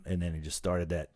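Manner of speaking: usual speaking voice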